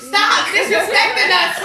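loud, slow mock scolding; telling a relative to drop the accent, loudly. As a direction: scold them for the fake accent. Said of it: "I be like".

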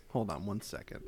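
usual speaking voice

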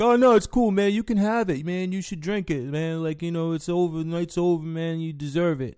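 high, loud mock drunk voice; generous drunk. Drunk customer offering the bouncer his pitcher, high loud drunk voice. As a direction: hand over the beer like a magnanimous drunk.

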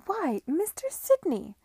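high, loud, drawn-out surprised greeting; slow, high and loud, startled to see him; surprised cry. Acted. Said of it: "she cried, evidently surprised".

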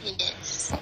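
soft unvoiced yes; whisper. Real, not acted. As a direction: insist under the breath. Whispered insistence.

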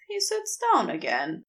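ordinary delivery

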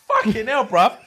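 high-pitched, incredulous reaction; acted-out disgusted disbelief; appalled outburst. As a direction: demand to know, voice shooting up. Acting out disgusted friend's loud reaction.

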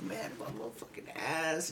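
very soft, low mock groaning; faint pained complaint. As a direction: say it weakly, as if hurt. Retelling hurt Daniel's groaned complaint.

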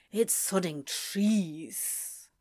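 under his breath. A narrator's reading. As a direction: say it softly to yourself, dragging it out. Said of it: "Crowley said under his breath".

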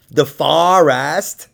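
"forest" stretched slowly as FAR-est; incredulous slow echo of "forest" as "fah-rast"; mocking mispronunciation. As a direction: mimic her "forest", dragging out a broad "FAH-rest". Mocking her pronunciation of forest.

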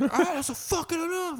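strained, high-pitched imitation of snapping; imitated snapping. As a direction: mimic a neighbor losing patience with a kid. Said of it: "comes off like he just snapped".

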